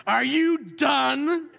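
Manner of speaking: very slow, drawn-out menacing question; slow deliberate impression of a stare-down; Jack Nicholson. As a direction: stretch each word out like a cold movie tough guy. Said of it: "my Jack Nicholson".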